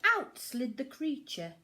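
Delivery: usual speaking voice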